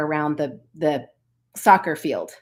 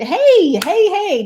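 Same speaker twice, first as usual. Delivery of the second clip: high, loud celebratory hey; cheering; 11 semitones higher, 7 dB louder, much slower than usual. Real, not acted. Cheering to her viewers about her big run, high and loud. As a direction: whoop it up like a victory cheer.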